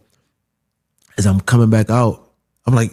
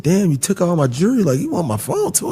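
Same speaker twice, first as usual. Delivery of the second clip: much higher disbelieving complaint; mock-indignant, pitched way up; incredulous protest; 9 semitones higher than usual. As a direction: squeak out the outrage. Protest to robber, retold.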